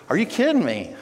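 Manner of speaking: high-pitched retold delight; beaming pride. Proud man hearing Colin is teaching, delighted. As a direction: exclaim it high, bursting with pride. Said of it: "he was just beaming, you know, he was so proud".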